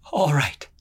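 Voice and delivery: a touch of shaking fear, falling; shaky voice. A narrator's reading. She stalls the brute, voice shaking slightly. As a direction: say it quickly to cover the hinge. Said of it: "Her voice shook".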